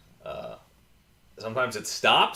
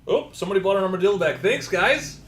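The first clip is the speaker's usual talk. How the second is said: excited; 10 semitones higher, 7 dB louder than usual. Real, not acted. Excited thanks for a sale.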